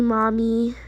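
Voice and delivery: ordinary talk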